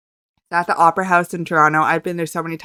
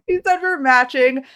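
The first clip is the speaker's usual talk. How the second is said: higher, thrilled restatement; giddy repeat; 6 semitones higher than usual. Real, not acted. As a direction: say it again as a swooning fan.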